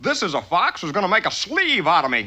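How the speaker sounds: ordinary talk, nothing marked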